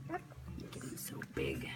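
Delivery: very soft low whisper. Real, not acted. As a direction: whisper it tenderly to the puppy. Whispering to puppy.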